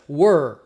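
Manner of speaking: "were" with a long curled-back R; caipira R. Shown as a demonstration. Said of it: "o R tem o som do R do caipira".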